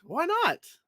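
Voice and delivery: silly voice. Acted. Playful repeat in put-on voice.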